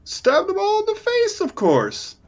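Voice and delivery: high-pitched breezy brag; boastful goblin hero. Acted.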